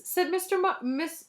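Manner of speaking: plain reading voice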